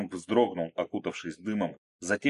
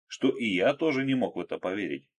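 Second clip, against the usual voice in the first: ordinary delivery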